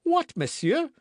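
very high, loud exclamation; startled young man's high outburst; astonished cry. A narrator's reading. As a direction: cry out in affronted surprise. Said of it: "cried D'Artagnan".

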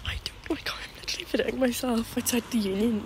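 embarrassed whisper in public. Real, not acted. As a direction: hush it so nobody hears. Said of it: "That's so embarrassing right now".

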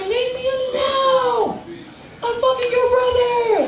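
very high, slow, screaming woman's voice; hysterical woman. Retold or imitated. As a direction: shriek high at the bystander.

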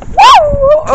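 loud, high whoop; excited whoop. Real, not acted. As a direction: cheer it out, thrilled. Excited cheer in wind.

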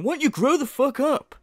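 put-on indignant scolding; mock straight-faced scolding. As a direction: imitate an earnest telling-off. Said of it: "proper with a straight face going".

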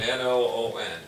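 N O O N spelled letter by letter. Shown as a demonstration. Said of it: "Same thing again".

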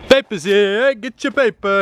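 high street-vendor cry; paperboy call. Pretend. Pretend paper man hawking papers, high calling out. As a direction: holler it out like a paperboy.

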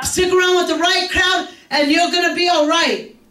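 a hint of a stern parent warning. Mother warning her kids about bad company, lightly stern. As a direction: lay down the law to the kids. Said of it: "I would tell them over and over".

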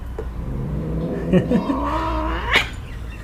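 long, squeaky breathy laugh; soft high-pitched laughter; high soft giggle. Real, not acted. Laughing at the jealous dog.

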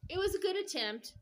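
forced cheer. Real, not acted. Grandma's forced upbeat verdict.

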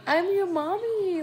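lightly acted, high-pitched mom talking to her baby; mommy to newborn. New mom meeting her crying baby, lightly acted mommy voice. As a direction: lift the pitch, cooing at a newborn. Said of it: "I'ma just look at him like".

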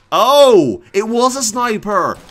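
loud, high cry of alarm; startled outcry. Real, not acted. Player spotting a sniper in game, startled outcry. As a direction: blurt it out loudly in sudden shock.

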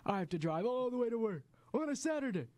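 high-pitched whining cartoon complaint; Toy Story impression. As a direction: imitate an exasperated toy in a high voice. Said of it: "is the Toy Story one".

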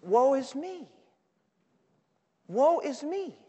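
a hint of Isaiah's lament, slow with long pauses; a lightly voiced prophet lamenting his own sin. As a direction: let the prophet break down, without volume. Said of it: "he goes into the temple".

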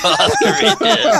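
laughing out loud through the name. Real, not acted. Cracking up at the nickname.

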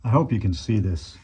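ordinary talk

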